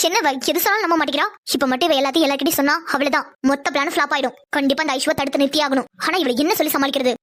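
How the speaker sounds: low, private plotting voice; scheming inner monologue. Acted. Girl wondering how to stop Aishu telling everyone, plotting low to herself.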